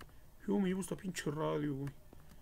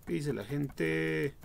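ordinary talk

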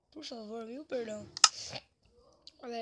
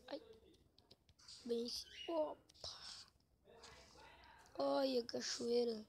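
ordinary talk, nothing marked

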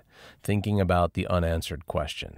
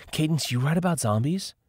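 Lauren's voice; 10 semitones higher than usual. A narrator's reading. Lauren voiced, higher pitch.

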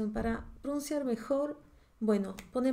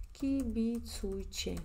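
very slow, syllables drawn out; dictado lento; 5 dB quieter, much slower than usual. Shown as a demonstration. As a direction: stretch out kibitsuiche as if dictating it. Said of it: "voy a escribir para que veas". (kibitsuiche muy lento, sílaba por sílaba)

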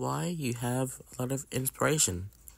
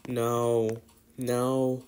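ordinary talk

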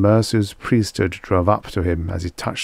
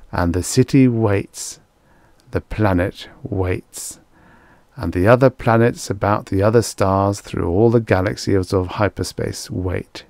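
plain reading voice

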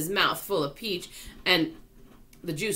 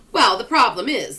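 loud; 4 semitones higher, 8 dB louder than usual. A narrator's reading. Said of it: "the earthworm said".

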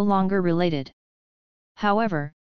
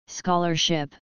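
light and offhand; slower than usual. A narrator's reading. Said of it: "the boy said lightly".

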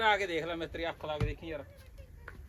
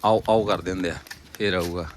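louder, lower, jesting at the meal; hearty loud banter; 7 semitones lower, 9 dB louder than usual. Real, not acted. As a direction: boom it out teasing everyone. (ਉੱਚੀ ਆਵਾਜ਼ ਵਿੱਚ ਮਜ਼ਾਕ)

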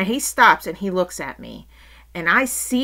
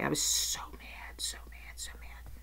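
fading, whispered anger; hushed, contained fury; seething whisper; 3 semitones lower, 13 dB quieter than usual. Real, not acted. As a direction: repeat it under the breath, fading away. Seething as she recalls walking away.

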